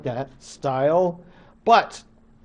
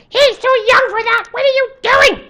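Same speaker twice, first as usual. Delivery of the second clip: much higher, louder panicked mother's voice; frantic mother; 8 dB louder than usual. Acted. Mother running from the wigwam, scolding her husband, high and panicked. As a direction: shriek at him that the boy is too young.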